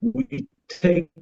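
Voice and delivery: ordinary talk, nothing marked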